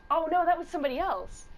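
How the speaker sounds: lightly voiced little old lady. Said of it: "and I'd be like".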